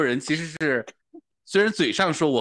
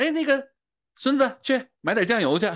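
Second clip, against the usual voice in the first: high, calling grandma voice; 12 semitones higher than usual. Retold or imitated. Grandma sends grandson for soy sauce, high calling voice.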